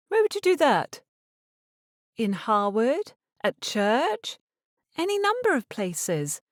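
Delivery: a hint of a young lady pushing back; lightly voiced Eliza. A narrator's reading. Eliza argues with her mother.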